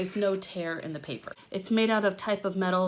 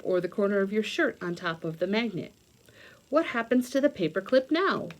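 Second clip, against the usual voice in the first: louder, bright read-aloud voice from a science book; 6 dB louder than usual. Acted. Reading a science book aloud to young viewers, louder storybook read.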